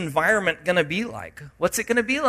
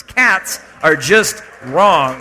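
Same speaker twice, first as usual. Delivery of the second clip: slow, louder deadpan joke; mock-serious teasing; 10 dB louder, much slower than usual. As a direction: pretend to mean it, then grin. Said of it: "I'm teasing".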